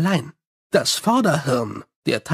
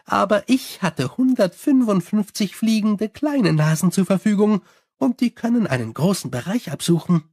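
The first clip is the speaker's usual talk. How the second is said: higher, chatty vampire voice; Sally complaining about her bats, higher and chatty; 5 semitones higher than usual. Acted. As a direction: rattle on cheerfully like a gossiping vampire.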